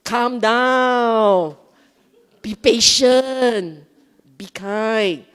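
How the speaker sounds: loud, drawn-out calming commands; self-talk commands. Shown as a demonstration. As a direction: stretch each phrase out firmly. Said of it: "So what must you say?".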